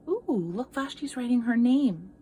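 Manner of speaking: usual speaking voice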